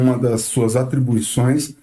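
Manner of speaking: ordinary delivery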